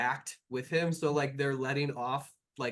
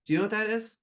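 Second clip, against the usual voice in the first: angry NPC; 7 semitones higher, 4 dB louder, much faster than usual. Acted.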